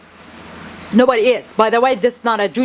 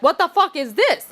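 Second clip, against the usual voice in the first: mildly incredulous, higher-pitched question; incredulous reaction; 7 semitones higher than usual. Retold or imitated. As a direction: retell your disbelief, pitch raised. Retells reaction to expensive bill.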